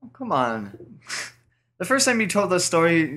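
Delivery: ordinary delivery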